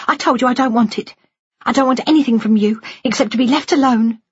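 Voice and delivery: high-pitched, insistent rejection; hurt daughter demanding to be left; angry daughter. Acted.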